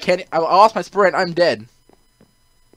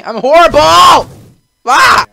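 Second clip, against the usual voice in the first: high, very loud, drawn-out yell; exasperated yell; 10 semitones higher, 16 dB louder, slower than usual. Real, not acted. Player admitting he is bad at the game, exasperated yell. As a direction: yell it out in despair at yourself. Player yells about own failure.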